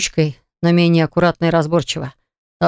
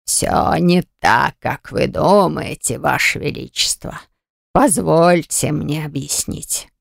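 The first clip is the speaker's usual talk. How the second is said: put-on old man voice; slower than usual. Oracle pleads after being choked.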